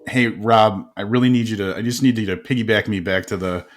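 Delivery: usual speaking voice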